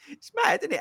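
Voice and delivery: high burst of real delight; excited exclamation. Real, not acted. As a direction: blurt it out, thrilled. Excited recap of race start.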